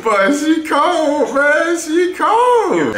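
very high, loud, drawn-out squeal; hyped outburst. Real, not acted. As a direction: holler it slowly in disbelief at the top of the voice. Reacting in hype to a highlight.

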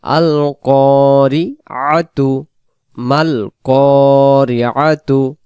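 slow chanted আল কারিআতু then মাল কারিআতু; slow recitation. Shown as a demonstration. Teacher contrasts the openings আল and মাল in slow recitation. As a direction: recite both phrases slowly with long vowels. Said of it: "এখানে আল, এখানে মাল".

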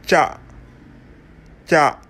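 "cha" from a closed mouth, releasing air at the end; plosive CHA. Shown as a demonstration. Teacher demonstrating the Pulaar alphabet, plosive "cha". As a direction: start "cha" shut, then let the air burst out. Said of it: "se prononce la bouche fermée".

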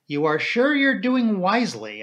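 understated serious doubt; grave question. A narrator's reading. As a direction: gently question the wisdom of it. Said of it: "I asked gravely".

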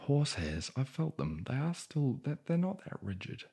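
rising, disbelieving rant; incredulous. Real, not acted. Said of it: "incredulous rant about horsehair oars".

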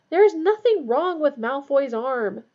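high, loud, drawn-out indignation; furious. A narrator's reading. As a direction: stress each word in angry protest. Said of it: "said Harry furiously".

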